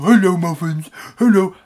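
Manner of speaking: high, loud doting call to a dog; dopey dog lover. Retold or imitated. Big dope greeting the customs dog, high and gushing. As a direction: sing out a gushing hello to a dog. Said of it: "I'm a big dope when it comes to dogs".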